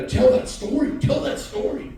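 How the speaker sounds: high, loud insistent call; urging call. Real, not acted. Speaker urging an audience member to share his story, loud call. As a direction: egg him on loudly.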